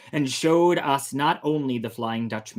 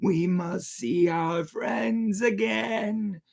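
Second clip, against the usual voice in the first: a very high wail, then slow crying words; piercing cry; 12 semitones higher, 9 dB louder, much slower than usual. Acted. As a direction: wail it in anguish like lost men. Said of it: "cried in a piercing tone".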